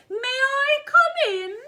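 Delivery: put-on very high, loud, drawn-out old lady; old lady head voice. Shown as a demonstration. Teacher demonstrating head register, silly old lady voice. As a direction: ring it out slowly in head voice. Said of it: "is our old lady voice".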